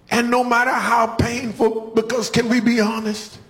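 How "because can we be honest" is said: usual speaking voice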